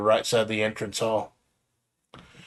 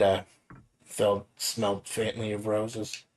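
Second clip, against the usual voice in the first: normal talking voice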